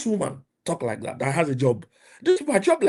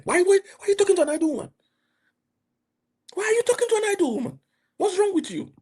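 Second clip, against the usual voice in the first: much higher, fast, incredulous scolding; exasperated outcry. Real, not acted. Preacher scolding men who chase idle women, high incredulous outcry. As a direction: throw up your hands: why would you do this.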